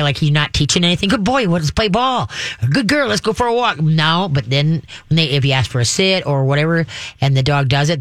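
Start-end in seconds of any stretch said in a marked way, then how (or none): 1.1-2.3 higher, drawn-out excited praise; playful praise. Shown as a demonstration. Owner invites dog to play, playful excitement. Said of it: "unless you're gonna play". 2.7-3.8 higher, lilting excited praise; playful praise. Shown as a demonstration. Owner invites dog out for a walk, playful excitement. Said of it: "unless you're gonna play".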